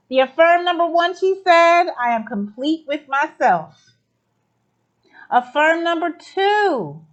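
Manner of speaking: calling out each affirmation loud and proud; big, rallying announcer voice; loud announcing. Real, not acted. Host rallies the group through their affirmations, loud and proud.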